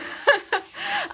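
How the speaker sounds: short high burst of laughter; real amused laugh. Real, not acted. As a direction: let a laugh break out. Laughs amid advice.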